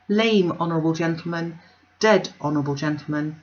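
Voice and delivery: ordinary delivery, nothing marked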